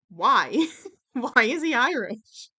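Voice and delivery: loud, baffled outburst; exasperated. Real, not acted. Vlogger baffled by a character's accent, loudly exasperated. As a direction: cry out in comic disbelief, stretching the words. Comic outrage at the odd accent.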